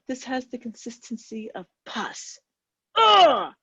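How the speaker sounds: reacting to the brie's texture with disgust. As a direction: recoil in disgust. Disgust at brie.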